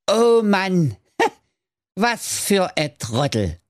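high squeaky voice laughing at a fool; mocking dwarfs. Dwarfs watch Mirko crash, squeaky and laughing. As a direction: chuckle down at the crash.

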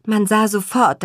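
usual speaking voice

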